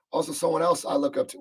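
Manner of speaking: ordinary talk, nothing marked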